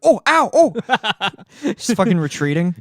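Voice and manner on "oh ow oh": high yelps of stepping on tacks; pained yelps. Pretend. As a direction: yelp like your foot got stabbed. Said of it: "and they're like".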